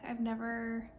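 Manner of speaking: ordinary delivery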